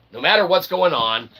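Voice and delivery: ordinary delivery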